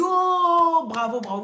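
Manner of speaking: high, loud drawn-out cheer; acted congratulation. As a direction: cheer like impressed doctors. Doctors congratulate the patient. (félicitations enthousiastes, jouées)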